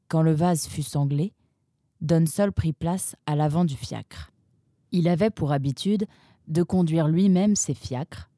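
ordinary delivery, nothing marked

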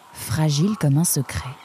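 plain reading voice

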